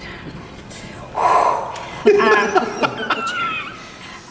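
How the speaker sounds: very high burst of laughter; squealing laugh. Real, not acted. Singer squealing with laughter about her June graduation.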